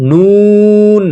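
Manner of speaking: নুন stretched long on a steady high pitch; three-alif nun. Shown as a demonstration. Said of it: "তিন আলিফ টান দিয়ে পড়ব". (তিন আলিফ টেনে নুন)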